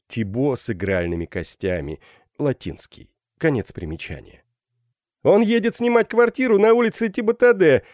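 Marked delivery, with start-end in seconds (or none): 5.2-7.8 high, loud cry of a joker; exclaiming. A narrator's reading. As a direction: shout the pun out for the crowd. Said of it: "воскликнул Жан Мельник". (громко воскликнуть)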